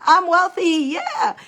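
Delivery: high, bright morning cheer; cheery affirmation. Shown as a demonstration. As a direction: wake up and cheer it out. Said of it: "if you wanna add the morning".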